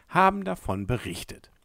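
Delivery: plain reading voice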